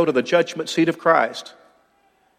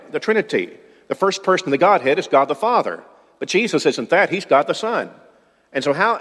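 ordinary talk, nothing marked

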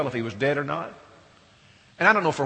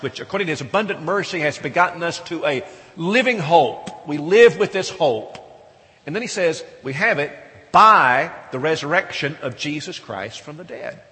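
louder, ringing reading of the verse; raised scripture reading; 6 dB louder than usual. Acted. Preacher reading scripture aloud to his church, louder and ringing. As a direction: proclaim the verse to the congregation.

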